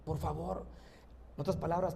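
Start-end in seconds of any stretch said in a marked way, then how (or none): 0.0-0.6 humble plea. Acted. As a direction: beg quietly for mercy. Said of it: "Y le empieza a decir".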